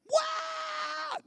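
high-pitched imitated shriek; sudden very high mock scream; woman's scream. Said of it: "hearing a woman scream".

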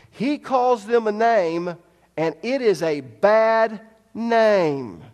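loud, high fervent preaching; preaching at full force. Acted. Preacher urging the congregation to remember, loud fervent preaching. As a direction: drive it home to the congregation.

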